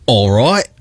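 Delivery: "All right" with an Australian accent. Shown as a demonstration.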